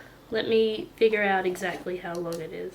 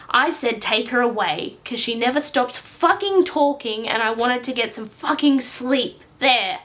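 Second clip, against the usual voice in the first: put-on loud, swearing furious boy; angry outburst; 3 semitones higher, 7 dB louder than usual. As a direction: blurt it out in anger. Frank's angry confession.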